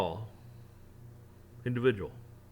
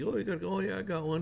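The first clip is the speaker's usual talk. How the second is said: put-on old lady voice; 8 semitones higher than usual.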